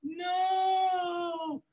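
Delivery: high drawn-out inner voice saying no; a lifted, stretched-out inner no; still small voice. Retold or imitated. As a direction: let the inner voice sing out a long high no. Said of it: "that still small voice will go".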